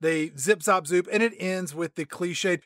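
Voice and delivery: ordinary talk, nothing marked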